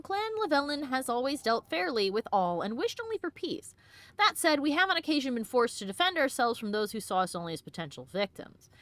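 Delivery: measured, formal read of a clan's letter. Acted. Player reading the Dalish clan letter aloud, formally. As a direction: read it like an official dispatch.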